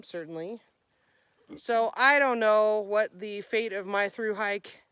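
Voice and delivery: ordinary talk, nothing marked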